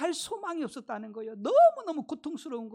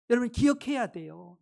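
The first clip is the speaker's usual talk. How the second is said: normal talking voice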